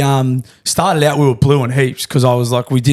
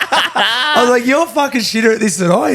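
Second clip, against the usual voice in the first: laughing; 10 semitones higher than usual. Real, not acted. Laughing while retelling agent's lowball.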